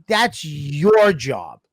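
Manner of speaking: "your" stretched out long for emphasis; drawn-out, insistent "your" before a falling end; stress on your. Real, not acted. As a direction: lean hard and slowly on "your". Ranting about the agent's duty.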